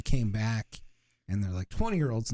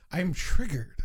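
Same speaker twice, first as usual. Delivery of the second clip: mock triggered; 6 semitones higher, slower than usual. Joking mock offense.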